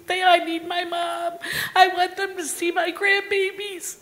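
high-pitched, emotional plea; pleading. Retold or imitated. Sons pray to keep their mom, pleading. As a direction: voice a son's imploring prayer. Retells sons praying for mother.

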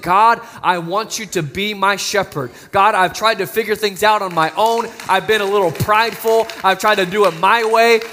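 preacher modelling a heartfelt plea to God; fervent prayer. Acted. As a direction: cry out to God in surrender. Voices a prayer of surrender.

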